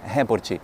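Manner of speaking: the Brazilian report, said with an added i. Shown as a demonstration. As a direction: report said "hé-por-tchi", Brazilian style. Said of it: "bem abrasileirado".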